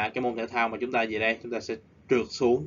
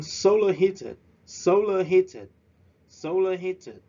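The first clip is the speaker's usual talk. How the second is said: SOlar HEAted, high and slow; stress on solar and heated; 8 semitones higher, 6 dB louder, slower than usual. Shown as a demonstration. Teacher drilling class: both first syllables stressed. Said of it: "We stress at the first syllable".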